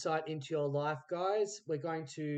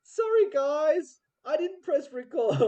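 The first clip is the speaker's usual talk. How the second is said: much higher, louder, slow sheepish apology with a laugh; sheepish Linda; 12 semitones higher, 11 dB louder, slower than usual. Retold or imitated. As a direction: play the embarrassed colleague confessing. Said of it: "Linda's like".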